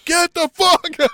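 very loud, high imitated scream; full-volume shout at a downed partner; Billy Gunn screaming. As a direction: yell it like a desperate ringside manager. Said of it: "is screaming".

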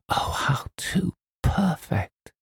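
slow, high rapturous gush; cry of delight. A narrator's reading. Girl sees the author's room, crying with delight. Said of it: "she cried".

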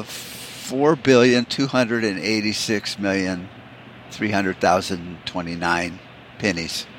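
slow, measured reeling-off of numbers; mock-certain answer. Dad answers son's impossible math question, slow and measured. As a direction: make up a huge figure slowly and deliberately.

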